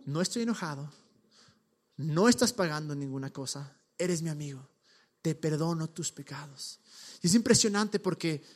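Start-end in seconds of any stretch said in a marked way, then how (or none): 0.0-0.9 soft, low and tender; tender reassurance. Retold or imitated. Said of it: "lo que hace Jesús en este momento es decirle". 2.0-6.8 tender reassurance. Retold or imitated. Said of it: "lo que hace Jesús en este momento es decirle".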